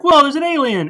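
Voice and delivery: high, loud startled whoa; cartoon surprise. Retelling a film to a friend, startled like the sheep. Sheep voiced in retold movie.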